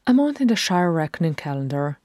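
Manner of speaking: ordinary delivery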